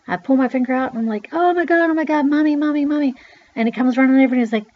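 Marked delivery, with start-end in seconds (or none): 1.3-3.1 high, loud wail of a hurt child, acted; panicked cry. Child with a hurt finger calls mother, panicked cry. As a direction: cry out for mommy in mock panic.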